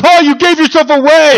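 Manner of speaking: real laughing gotcha; laughing tease. Real, not acted. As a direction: crow it out gleefully. Teasing his co-host.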